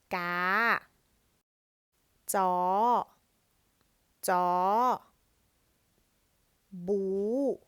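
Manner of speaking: "Ga", "Jaw", "Bu" each said loud with a high tone rising at the end; Thai high tone. Shown as a demonstration. Teacher drilling students on Thai high tone syllables, loud and clear. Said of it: "it makes a high tone".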